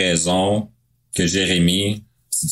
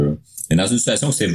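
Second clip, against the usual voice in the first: normal talking voice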